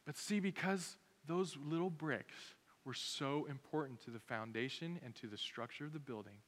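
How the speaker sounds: ordinary talk, nothing marked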